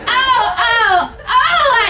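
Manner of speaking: high, loud, hammed-up cries of oh; a mocking, over-the-top scream. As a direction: overact the pleasure cries for laughs. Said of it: "I screamed".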